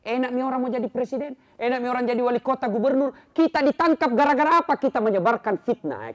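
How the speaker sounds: loud, high warning sermon; full-force preaching. Acted. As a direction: warn the crowd with rising force. (khotbah peringatan yang lantang)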